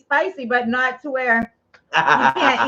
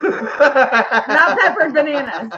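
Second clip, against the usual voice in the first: laughing; much slower than usual. Real, not acted.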